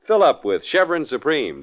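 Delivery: normal talking voice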